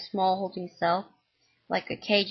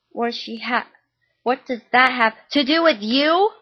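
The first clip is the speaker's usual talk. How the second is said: louder, higher furious yell; mom yelling; 4 semitones higher, 7 dB louder than usual. Acted. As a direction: yell it at your son in fury. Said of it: "she yelled".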